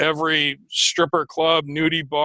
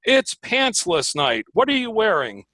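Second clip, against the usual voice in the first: higher-pitched imitation of a daughter; excited daughter; 8 semitones higher than usual. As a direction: play the daughter delivering juicy news. Voicing his daughter's teasing news.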